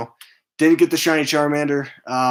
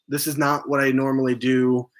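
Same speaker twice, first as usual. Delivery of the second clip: usual speaking voice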